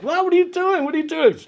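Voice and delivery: very high, bewildered mock protest; sleepy confused kid. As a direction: squeak it out half-awake. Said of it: "I'm like".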